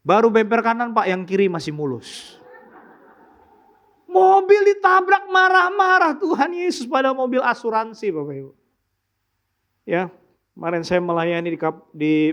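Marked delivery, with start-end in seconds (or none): 4.1-8.1 preaching rebuke. Real, not acted. Preacher rebuking angry Christians.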